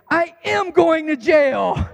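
high, slow shouted imitation of a wailing son; mock child's shout, drawn out word by word; declaring child. Son declaring his fate to dad, imitated loud cry. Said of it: "he declares".

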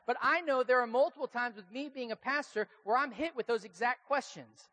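ordinary talk, nothing marked

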